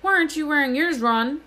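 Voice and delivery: high, loud insistent question; loud demand. A narrator's reading. George questions Ron about his sweater, demanding loudly. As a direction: demand an answer at full energy. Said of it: "George demanded".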